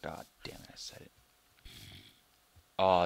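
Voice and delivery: usual speaking voice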